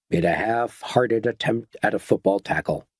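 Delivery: ordinary delivery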